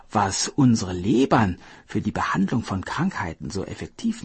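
sly hare explaining smoothly; lightly voiced hare. Hare inventing the hidden-liver story for the king, smoothly. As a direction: spin the tale as if it were obvious. Hare spins his lie.

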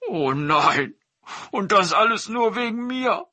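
tiger wailing, high and tearful; a big beast breaking down over his mother; sobbing tiger. Acted. Tiger laments his mother's suffering, high and tearful. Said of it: "schluchzte der Tiger".

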